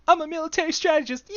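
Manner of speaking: high, loud, hammy general; silly general voice. Acted. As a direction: play a pompous squeaky general. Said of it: "Baby plus General plus [name]".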